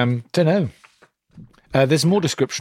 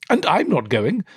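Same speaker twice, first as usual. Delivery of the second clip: a second sister sneering no; sulky stepdaughter; 7 semitones higher than usual. Acted.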